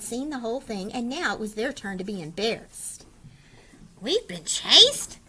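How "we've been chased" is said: mild moan. Acted. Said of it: "they moaned".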